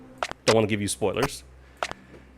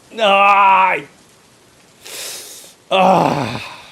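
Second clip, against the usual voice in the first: dismayed whimper; 8 semitones higher, 4 dB louder, much slower than usual. Real, not acted. Dreading night seven.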